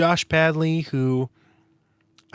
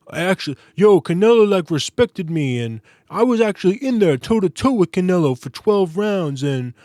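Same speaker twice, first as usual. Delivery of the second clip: put-on louder, brash Edgar Berlanga New York accent; mock his New York swagger, louder; 2 semitones higher, 4 dB louder than usual. Mocking Edgar Berlanga's trash talk in a loud New York accent. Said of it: "the accent is, like, definitely at least half forced".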